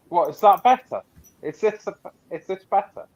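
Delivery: projecting over a bad connection. Real, not acted. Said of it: "you raised your voice".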